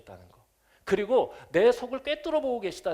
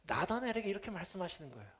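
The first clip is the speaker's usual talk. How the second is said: ordinary talk, nothing marked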